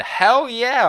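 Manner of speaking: high, loud, slow hell yeah; defiant cheer. Real, not acted. Fan proudly admitting to chat he's overreacting, loud cheer. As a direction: shout it proudly.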